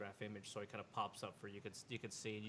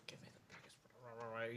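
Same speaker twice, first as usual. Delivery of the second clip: slow, softer hushed request; whispering; 8 dB quieter, slower than usual. Acted. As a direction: covert whispered demand. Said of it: "whispers, just goes".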